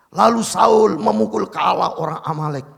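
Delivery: shouted, high reading; preacher shouting the verse about Saul to the church; proclaimed scripture. Acted. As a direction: thunder the verse out.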